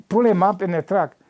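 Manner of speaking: ordinary talk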